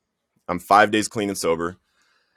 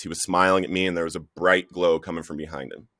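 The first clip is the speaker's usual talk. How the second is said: ordinary talk, nothing marked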